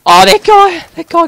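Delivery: high, loud exclamation; excited outburst. Real, not acted. Gamer reacting to a kill, excited outburst. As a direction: burst out over the kill.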